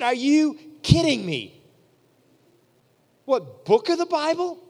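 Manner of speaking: high, loud mock disbelief; the young preacher balking at learning a book, incredulous. Retelling disbelief at memorizing.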